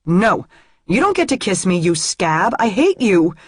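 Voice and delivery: loud, angry refusal of a kiss; angry shout. Acted. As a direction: push him away with your voice. Pulling back from kiss, furious.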